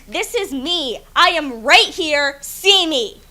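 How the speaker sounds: loud, slow defiant cry; slow loud declaration, each word held; scream it out. Acted. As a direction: shout to be seen. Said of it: "You make me want to scream".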